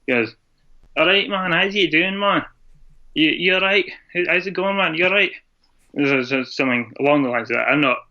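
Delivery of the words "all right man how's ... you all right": high, sing-song imitation of Glaswegian; Glaswegian accent. Scot from Aberdeen showing an American Glasgow talk, mock Glaswegian accent. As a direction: put on a higher-pitched Glasgow greeting. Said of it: "is predominantly from Glasgow".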